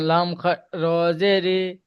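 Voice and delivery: slow, syllable by syllable; staccato. Shown as a demonstration. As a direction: chop each syllable apart, slowly. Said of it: "কেটে কেটে বলুন".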